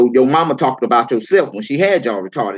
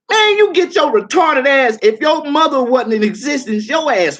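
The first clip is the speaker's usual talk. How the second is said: angry yell; 10 semitones higher, 3 dB louder than usual. Real, not acted. Yelling at troll John Frake.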